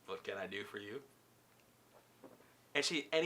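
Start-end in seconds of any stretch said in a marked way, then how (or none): 0.0-1.0 low, hushed question with rising end; quiet, intimate low-voiced question; soft repeat. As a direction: say it very softly and low, lifting at the end.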